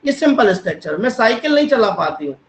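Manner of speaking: ordinary delivery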